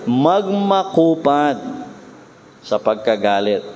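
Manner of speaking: Magmakupat sa pagkagalit spoken very slowly, dropping low on pagkagalit; slow emphasis. Shown as a demonstration. Pastor repeats the verse for emphasis, slow and low. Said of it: "Di ba?".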